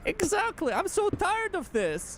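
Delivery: high whiny cartoon complaint; exaggerated cartoon intonation; cartoon character voice.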